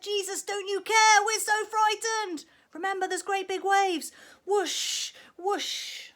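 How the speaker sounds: high, loud, panicked pleading; frightened shout. Acted. Fishermen shouting to Jesus over the storm, high and loud. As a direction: yell for help over the storm. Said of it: "So they shouted".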